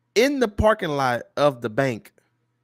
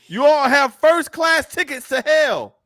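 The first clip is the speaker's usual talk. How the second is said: high, loud, slow mock condemnation; street preacher; 14 semitones higher, 7 dB louder, slower than usual. Preacher telling people at red lights they are doomed, loud drawn-out mock yell. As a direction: holler it out like a hellfire preacher. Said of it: "Listen to what they're telling you".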